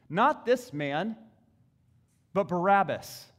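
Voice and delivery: loud, slow shouted cry; crowd cry. A narrator's reading. Preacher voicing the crowd demanding Barabbas, loud shout. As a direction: shout it like an angry mob. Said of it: "And they cried out".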